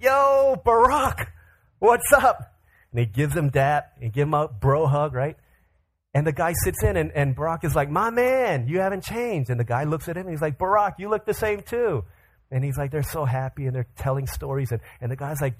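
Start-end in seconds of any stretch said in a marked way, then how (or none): 0.0-2.5 loud, high-pitched buddy shout; hyped greeting. Acted. Old friend greets Barack on entering. 7.9-9.5 high, drawn-out homie welcome; acted pally enthusiasm; delighted greeting. Barack greets his old friend. 10.6-12.0 higher-pitched acted buddy banter; mock reunion enthusiasm; pally greeting. Friend returns the greeting.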